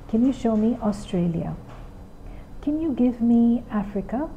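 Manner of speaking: put-on soft, patient classroom voice to a small child; gentle teacher voice. Shown as a demonstration. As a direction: guide the child calmly through the lesson. Teacher's demo lesson with child.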